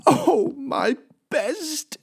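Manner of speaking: high, slow wail; anguished wail. Acted. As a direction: moan it like a tragedy. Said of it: "she said".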